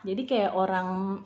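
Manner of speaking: ordinary talk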